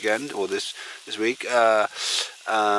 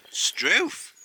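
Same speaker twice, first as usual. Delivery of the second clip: put-on "Struth" in a high Australian accent, rising at the end; 9 semitones higher than usual. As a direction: exclaim "Struth" like an Aussie. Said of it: "I can't do the accent".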